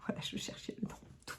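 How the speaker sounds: unvoiced whisper with a small laugh. Real, not acted. Knitter whispering to the camera after finding the word, hushed. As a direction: whisper it, relieved to find the word.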